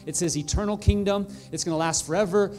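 loud, high, exultant preaching; triumphant pulpit climax; full-force preaching. Acted. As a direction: proclaim the good news with fire.

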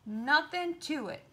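loud fake bravado; a touch of false bravado; fibbing. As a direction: bluff that he wasn't scared. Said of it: "the fish fibbed".

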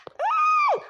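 a sudden piercing high scream; high shriek. Acted. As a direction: shriek as the child lunges at her. Child attacks visiting woman. (tiz bir çığlık)

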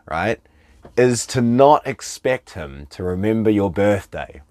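ordinary talk

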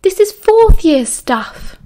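high, drawn-out exasperation; exasperated. A narrator's reading. Lily scolding Remus over an easy potions question, exasperated. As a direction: stretch it out in high-pitched disbelief.

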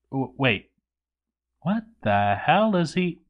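plain reading voice

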